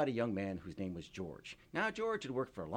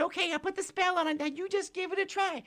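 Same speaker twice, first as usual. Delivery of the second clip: tiny pixie voice; 8 dB louder than usual. Acted. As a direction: squeak like a tiny tearful fairy. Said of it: "She said".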